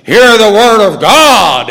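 loud, slow, commanding proclamation; booming preacher voice. Retold or imitated. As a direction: boom it from the pulpit. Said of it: "he opened up by saying".

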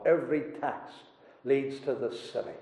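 soft and low, half to himself; quiet echo. Acted. Preacher echoing the old divine, hushed. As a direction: let the line sink to a hush.

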